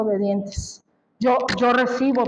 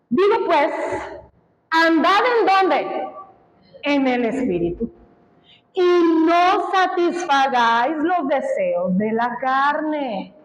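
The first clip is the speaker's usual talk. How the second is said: emphatic scripture; 2 semitones higher than usual. Acted. Preacher reading verse emphatically.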